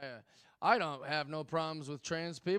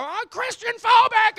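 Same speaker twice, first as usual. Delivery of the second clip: much higher and louder shrill-voiced mockery; comic mocking an imagined meltdown, high and loud; mock whiny outburst; 13 dB louder than usual. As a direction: squeal it like a hysterical caricature. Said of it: "I won't crash out and go".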